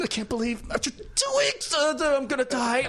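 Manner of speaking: high, pained reading of a dying line; strained dying line. Retold or imitated. Actor reading the death scene while in pain, strained. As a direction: push the line through the pain. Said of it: "like that".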